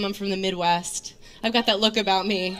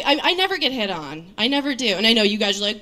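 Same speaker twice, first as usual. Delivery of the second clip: normal talking voice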